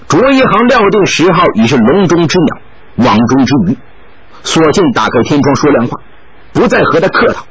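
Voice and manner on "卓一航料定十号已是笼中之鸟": usual speaking voice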